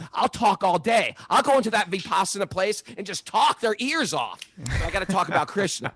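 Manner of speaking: high, lively mock bragging; playful boast. Speaker jokes to friends about talking their ears off, playful. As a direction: tease yourself as a chatterbox.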